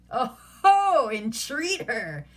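loud high jeer, then slow; mocking scoff. A narrator's reading. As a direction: sneer at the word entreat. Petruchio mocking "entreat.

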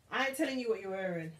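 ordinary talk

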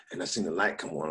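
normal talking voice

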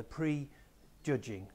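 prejudging drawn out very slowly; slowly split word. Shown as a demonstration. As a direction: stretch out prejudging so the two parts show. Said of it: "Prejudice is two words joined together".

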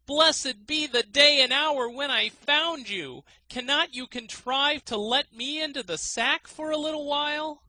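high, loud, eager plea; eager student. A narrator's reading. Student begs to enter the sack, high and loud. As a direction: beg with rapt eagerness. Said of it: "At last he said".

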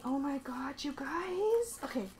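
breathless whispered awe; hushed awe at the box contents. Real, not acted. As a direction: whisper it, overwhelmed. Overwhelmed by unboxing.

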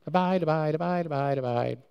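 rattled off carelessly, like a cliché; tossed-off buzzword. Shown as a demonstration. Preacher mocking how people toss the word around. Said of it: "It can be thrown around pretty easy".

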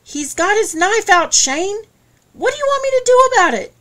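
rushing, near-hysterical plea; frantic. A narrator's reading. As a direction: son panicking at his father's knife. Shane frantic over knife.

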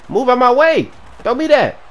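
high, loud burst; urgent yelling. Real, not acted. Runner yelling at defenders, urgent yelling. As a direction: shout them out of the way.

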